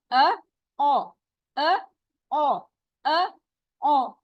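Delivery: अ light, then ओ full and heavy; heavy and light vowels. Shown as a demonstration. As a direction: contrast a thin अ with a full, rounded ओ. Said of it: "मोटा बोलने के लिए हमने क्या practice".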